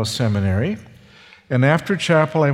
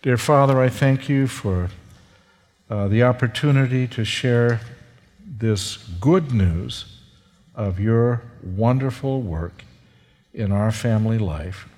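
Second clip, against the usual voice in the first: closing prayer; 4 semitones lower than usual. Acted. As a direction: pray it humbly and tenderly.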